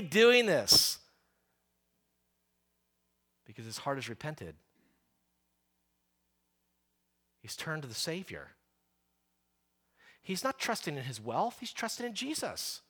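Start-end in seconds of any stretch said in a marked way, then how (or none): 3.5-12.8 soft, hushed earnest sermon tone; preacher hushing the congregation, soft; quiet intensity. Real, not acted. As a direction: lower the voice to draw them in. Preacher drops to quiet emphasis.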